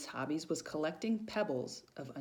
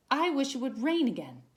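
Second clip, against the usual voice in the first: put-on young donkey voice; 6 semitones higher, 5 dB louder than usual. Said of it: "and said".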